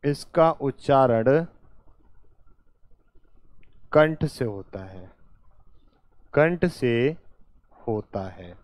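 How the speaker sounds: measured, halting pace for writing along; slow dictation. Real, not acted. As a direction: dictate in short phrases with long gaps. Teacher dictates phrase by phrase. (धीरे-धीरे, टुकड़ों में लिखवाते हुए)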